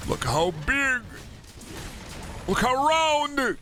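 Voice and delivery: put-on slow baby-talk gush over the round creature; cutesy cooing. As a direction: coo at it like a cute pet.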